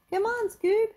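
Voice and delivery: very high, urgent call; high-pitched cartoon shout; Shaggy shouting. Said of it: "shouted Shaggy".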